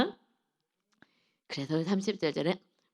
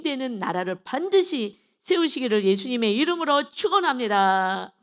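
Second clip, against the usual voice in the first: raised, forceful exhortation; impassioned preaching; 4 semitones higher, 4 dB louder than usual. Real, not acted. Preacher exhorts congregation to cry out to the Lord, preaching. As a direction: urge the congregation on, swelling into a loud blessing.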